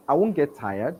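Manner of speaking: normal talking voice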